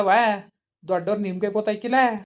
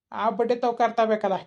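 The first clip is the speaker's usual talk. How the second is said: ordinary talk, nothing marked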